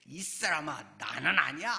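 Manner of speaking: loud acted protest, punched hard; forceful denial. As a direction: deny it emphatically, as if acting out the scene. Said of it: "더 이제 힘주어서 강하게 부인한다는". (힘주어 강하게 부인하는 흉내)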